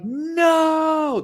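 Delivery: high shouted no of despair; screaming no. Retold or imitated. Fan mimicking Juggernaut fans' high desperate scream. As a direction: yell it like your fighter just fell. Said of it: "They're screaming".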